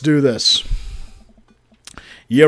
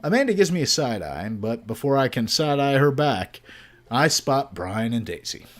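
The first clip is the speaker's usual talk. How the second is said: ordinary delivery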